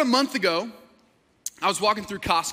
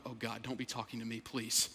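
very low, hushed, quick inner thought; muttered private plea; 10 semitones lower, 15 dB quieter than usual. Retold or imitated. Pastor dreading stranger in Costco, hushed low mutter. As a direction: pray it under your breath. Retold dread of being approached.

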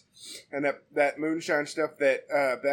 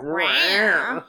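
sexy meow; 4 dB louder than usual. Pretend. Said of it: "sounded more sexy than anything".

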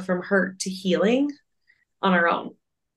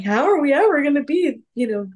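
upset Bing Bong; 6 semitones higher than usual. Retold or imitated. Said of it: "he's like".

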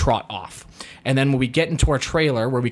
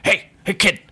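calling out; 7 semitones higher, 5 dB louder than usual. Retold or imitated. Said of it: "I can't be like".

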